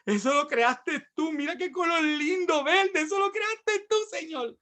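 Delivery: high, climbing weepy whine; imitating his own weeping wonder at creation; mock tearful crying. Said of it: "yo lloraba nada más por ver la hierba".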